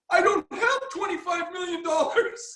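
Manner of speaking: high, laughing mock wail; panicked sergeant. Man mocking the sergeant's panic, high with laughter. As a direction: squeal the sergeant's fear through a laugh.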